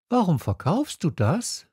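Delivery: put-on high, questioning young voice; Little Prince. A narrator's reading. Prince questions the pill merchant, high voice. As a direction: ask the trader in a high voice. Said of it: "sagte der kleine Prinz".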